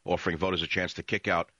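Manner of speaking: ordinary talk, nothing marked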